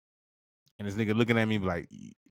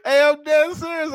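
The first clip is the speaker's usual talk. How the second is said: high and loud, insisting; insistent; 7 dB louder than usual. Real, not acted. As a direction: shout that you mean it. Insisting loudly.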